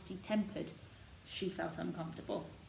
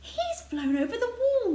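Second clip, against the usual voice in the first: higher, louder excited cry; 9 dB louder than usual. A narrator's reading. Mary crying out as the robin flies away, excited and high. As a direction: call out after the bird in delight. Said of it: "Mary cried out".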